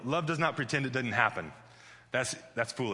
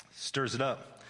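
ordinary talk

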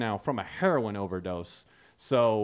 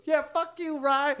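very high squeaky sidekick taunt; mocking little Austin; 5 dB louder, slower than usual. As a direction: ape the tagalong, pitched way up. Imitating tiny Austin joining in.

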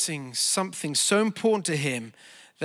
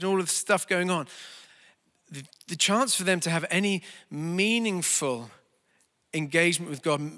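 ordinary talk

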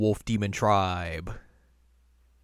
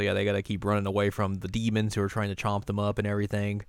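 ordinary talk, nothing marked

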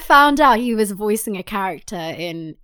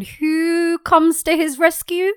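high, slow storyteller suspense; drawn-out, pitched-up rhetorical question; theatrical build-up; 7 semitones higher, slower than usual. Pretend. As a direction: tease the reveal with mock grandeur.

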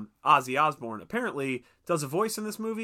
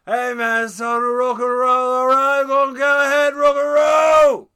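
much higher, louder shouted rocker; 11 semitones higher, 13 dB louder than usual. Retold or imitated. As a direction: yell it like a stoned rock star. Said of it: "been like".

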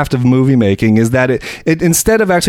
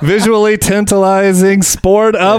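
slow, drawn-out mock announcer plug, pitched up; sports commentator; 4 semitones higher, much slower than usual. Mocking commentators plugging sexy volleyball, announcer voice. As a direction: imitate a commentator hyping the event word by word. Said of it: "commentators are all like".

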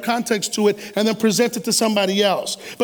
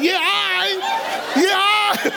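mocking; 8 semitones higher, slower than usual. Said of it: "You're like".

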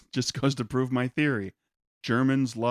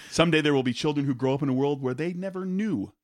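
ordinary delivery

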